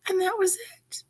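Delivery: whispered "and that was it"; hushed aside. A narrator's reading. Brief nod from cool bean.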